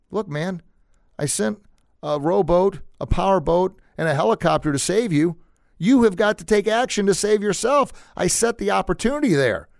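high, emphatic voice playing God; exasperated rebuke. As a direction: lay it out item by item, rising in force. God scolds the drowned man.

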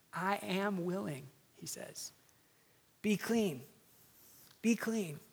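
Jesus healing the leper, quiet and tender. Acted. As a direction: speak it softly to the sick man.

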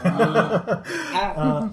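high laughing burst. Real, not acted. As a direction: laugh through it. Laughing at goblin.

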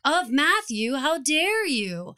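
hammed-up accusing outburst, words stretched; mock outrage.

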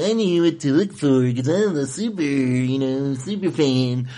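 lightly voiced smug nerd; a hint of a know-it-all super fan. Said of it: "that was my weird nerd voice".

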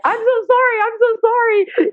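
very high imitation of a distressed friend; girlfriend's apology. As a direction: mimic your friend in a high-pitched voice. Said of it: "my girlfriend who's beside me is like".

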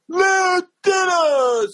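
very high, stretched-out holler; drunk fan. Retold or imitated. Fan turns up at the next meet and greet, drawn-out holler. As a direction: imitate a superfan wailing the name, long and high. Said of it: "I'm doing the meet and greet".

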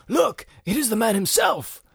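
high, loud, stilted fake British; Keanu British accent impression. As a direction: ham up a bad period English accent. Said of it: "and he goes".

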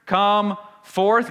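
loud, high command to a dead man; commanding call. Acted. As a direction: call him out of the tomb. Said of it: "Jesus commanded".

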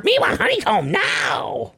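high shouted creature chant; cereal mascot. Retold or imitated. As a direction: squeal it like a crazed mascot. Imitating the Craver commercial chant.

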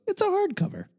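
high squeaky impression of a cohost; imitating Joe Patrick. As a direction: mimic his signature line, high and light. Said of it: "Joe Patrick goes".

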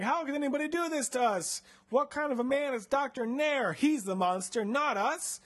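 high-pitched hammed-up indignation; outraged. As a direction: rail against the injustice. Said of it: "we're outraged".

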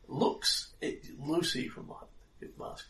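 normal talking voice

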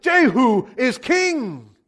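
high, drawn-out acclamation; slow, ringing crowd cry; proclaiming shout. A narrator's reading. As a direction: shout it like a herald, stretching each word. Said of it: "blew the trumpet and shouted".

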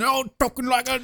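high, hammed-up British voice; mock British accent. Mocking Statham's lone British accent, high put-on voice. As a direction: parody the lone Brit. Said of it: "he's the only one".

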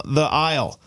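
ordinary talk

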